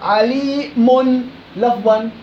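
very slow, عليم spoken as عليمٌ with a clear nun; slow teaching demo of عليم with audible nun; sounded nun of tanween. Shown as a demonstration. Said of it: "تثبت لفظًا لا خطًا".

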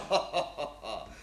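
short scornful chuckle; mocking laugh. Captain scoffs at the peasant son, mocking laugh. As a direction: laugh off the boy's threat.

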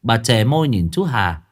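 ordinary delivery, nothing marked

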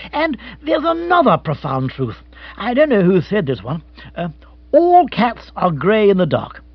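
emphatic, pompous gentleman; bombastic pep talk. Acted. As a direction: deliver it as a profound truth. Rory goes on with the pep talk.

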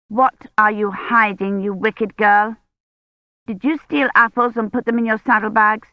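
put-on emphatic, accusing older-sister voice; stern cross-examining girl; bossy sister Rosamond, accusing. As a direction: scold your little sister accusingly.

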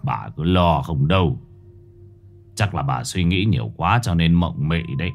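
put-on low-pitched, mildly cross older man; quietly gruff husband. Husband brushing off wife's fears, mildly gruff. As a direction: dismiss her worry in a low grumble. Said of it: "Ông Thông khẽ gắt".

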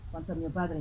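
usual speaking voice

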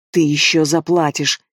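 ordinary delivery

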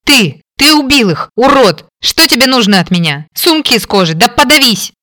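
high furious rasping yell; hoarse angry yelling. Acted. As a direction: rasp out the fury while struggling. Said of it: "Хрипела".

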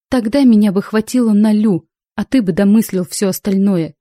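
usual speaking voice

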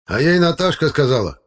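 high, loud triumphant cry. Acted. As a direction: crow it out like a winning point. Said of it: "победно выкрикнул дядя Лёня". (победный выкрик)

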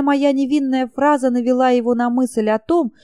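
ordinary delivery, nothing marked

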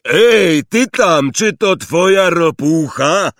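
high, loud shout-out; loud hailing question. A narrator's reading. Hagrid checking boats, calling to a boy about a toad, loud. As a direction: hail someone across the landing. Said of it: "zapytał Hagrid".

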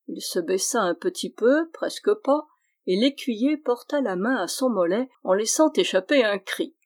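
ordinary delivery

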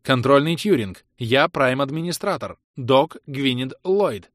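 loud, slow, every word spaced out; loud and deliberate. Acted. Gwyneth declares herself to the AI, loud and spaced out. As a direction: speak as if to a simpleton. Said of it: "Громко и раздельно, будто говоря со слабоумным".

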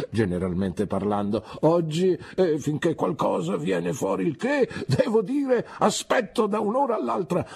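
put-on self-important gentleman lecturing the boy; Mr Micawber. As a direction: hold forth grandly, then trail off. Micawber gives advice.

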